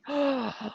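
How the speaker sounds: sharp, acted gasp of fear; fearful gasp. Showing butterflies of fear in my tummy, fearful gasp.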